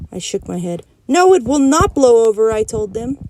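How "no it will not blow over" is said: firm outburst. Acted. Junie B defies her parents.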